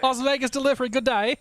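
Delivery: put-on high, loud hammy Australian accent; a clumsy go at an Aussie accent; bad Aussie accent. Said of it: "My Aussie accent's real bad".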